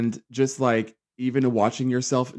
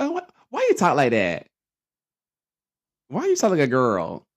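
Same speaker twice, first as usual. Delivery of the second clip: a hint of a jeering bully voice; mocking bully; 5 semitones higher, 4 dB louder than usual. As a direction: jeer like a taunting kid. Bullies mocking his voice.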